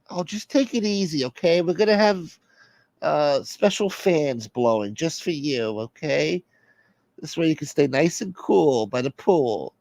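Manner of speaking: high-pitched voice calming someone down; exasperated soother, placating. Acted. Plays the soother.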